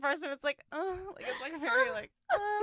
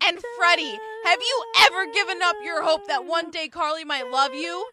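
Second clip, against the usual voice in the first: loud, higher rallying speech; Sam's pep talk; 7 semitones higher, 8 dB louder than usual. Retold or imitated. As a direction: pump up the team like a brash captain. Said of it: "She's like".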